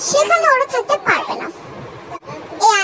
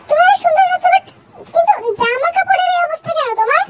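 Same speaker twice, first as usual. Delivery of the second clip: higher-pitched, flustered questioning; flustered mother; 6 semitones higher than usual. Acted. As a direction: balk at the outfit, pitch well up. (হতভম্ব মায়ের চড়া গলা)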